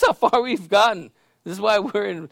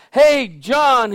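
raised call down to someone below; higher, louder retold hail; 6 semitones higher, 7 dB louder than usual. Pastor calls down from his window to homeless man, calling out.

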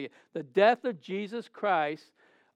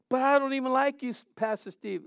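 mock church member; 8 semitones higher than usual. Congregant's objection voiced by pastor.

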